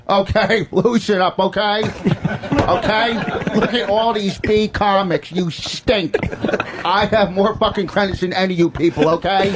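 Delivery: high-pitched comic impression with punched okays; Rich Voss. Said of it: "All right, we'll do Voss first".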